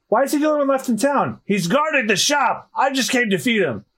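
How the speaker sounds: a hint of high elderly character; old mayor answering. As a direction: explain with a touch of an elder's fussiness.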